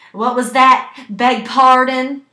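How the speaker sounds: high, very loud, stretched-out acted query; loud incredulous asking. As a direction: act as if you can't hear, loudly. Staff not listening to patient's answer.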